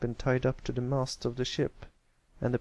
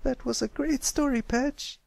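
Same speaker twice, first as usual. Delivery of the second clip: put-on young filly voice. Filly praises story.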